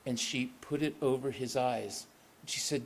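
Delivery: usual speaking voice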